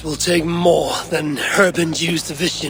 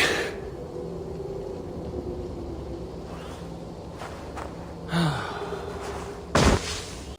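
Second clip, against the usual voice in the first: long weary sigh; 5 semitones higher, 9 dB quieter than usual. Acted. Collapsing from weakness.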